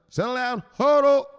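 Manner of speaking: high, loud settle down; mock shout. Enforcer barking at the investor, high and loud. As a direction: bark it at him. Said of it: "So Burchetta tells him".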